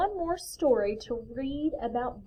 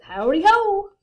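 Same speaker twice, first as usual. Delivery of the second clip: hearty greeting; 10 semitones higher, 11 dB louder than usual. A narrator's reading. Hare hopping along to the friends, hollered greeting. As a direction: sing out a hello from afar.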